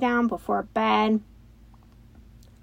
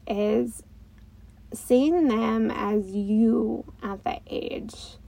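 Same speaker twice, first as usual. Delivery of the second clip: ordinary talk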